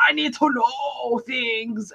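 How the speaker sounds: mock angry cop, drawn out; high, slow, strained-out imitation of a demand; Gary Oldman. Imitating an angry police officer in the film, mock angry. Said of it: "being an angry police officer".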